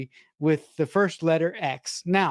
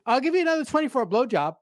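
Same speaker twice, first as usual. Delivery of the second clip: fast, higher mock sleazeball voice; sleazy offer; 7 semitones higher, much faster than usual. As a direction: rattle off the crude offer like a creep. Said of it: "He goes".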